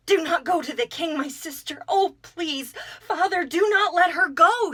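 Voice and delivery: high, loud, frantic begging; hysterical crying plea. A narrator's reading. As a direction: plead in panic, near tears. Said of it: "she cried hysterically".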